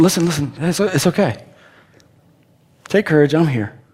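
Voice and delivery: soft, gentle comfort; reassuring. Retold or imitated. Jesus comforting the disciples in the boat, gently soothing. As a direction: hush them, they're safe.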